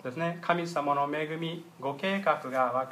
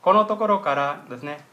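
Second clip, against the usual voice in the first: ordinary talk, nothing marked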